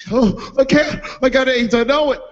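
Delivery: high, distraught comic voice; imitating a hysterical bystander; mock panicked victim. Retold victim panicking about disease.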